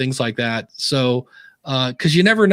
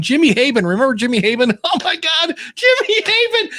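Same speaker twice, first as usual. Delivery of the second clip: building to a high laughing squeal; hammed-up shrieking hilarity, ending in laughter; mock hysterical laughter; 14 semitones higher than usual. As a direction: act out friends cracking up at an inside joke. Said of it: "laugh our butt off".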